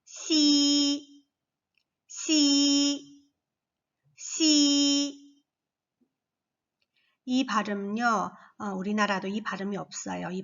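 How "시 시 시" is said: high 시 repeated three times; syllable drill. Shown as a demonstration. Teacher drilling 시 with children. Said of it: "X가 또 아니겠죠".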